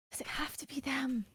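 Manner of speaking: breathy conspiratorial whisper. Acted. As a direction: lean in and whisper it to the cat. Mage complaining to her cat.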